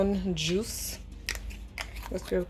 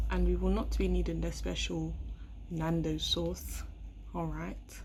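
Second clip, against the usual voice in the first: quiet voice; 7 dB quieter than usual. Real, not acted. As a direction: keep it hushed and secret.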